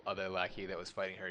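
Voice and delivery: ordinary delivery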